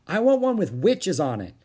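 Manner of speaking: put-on high, loud childish demand; demanding boy. A narrator's reading. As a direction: demand it like an indignant small boy. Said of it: "demanded a boy".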